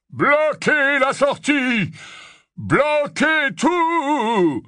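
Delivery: very slow, loud, high barked command; shouted orders. Acted. Yaxley storming out of the lift, shouting orders. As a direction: drag out each order as a shout.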